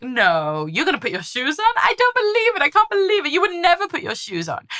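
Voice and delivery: high, loud, mock-disbelieving silly voice; silly contrary voice. Parent teasing child into putting shoes on, in a silly high mock-astonished voice. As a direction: play the disbelieving clown to get shoes on. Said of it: "contrary and silly".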